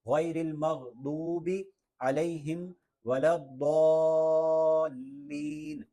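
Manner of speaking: recited, with "الضالین" held long and loud; lengthened daad. Shown as a demonstration. Teacher demonstrates for learners, long-held "الضالین". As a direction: sustain "الضالین", then join it to the laam. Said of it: "لمبا کرتے کرتے اس کو لام سے بھی ملا".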